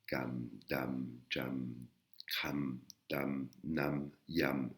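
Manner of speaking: Kam, dam, jam, kram, dam, nam, yam chanted one by one, soft, partly whispered; soft whispered syllable drill for pupils; Thai syllable drill. Shown as a demonstration. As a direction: run through the Thai syllable list like a classroom drill. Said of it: "et on répète comme ça".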